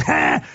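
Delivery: short sharp "ha!"; mock effort cry of the struggling little guy; imitated exertion noise. Imitates himself beside bigger teammates.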